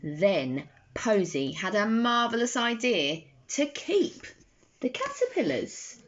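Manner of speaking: plain reading voice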